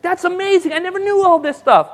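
father won over; amazed. Retold or imitated. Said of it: "He's like".

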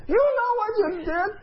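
high-pitched livid accusation; imitated angry yelling. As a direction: screech it as the furious woman. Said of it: "She's yelling at me".

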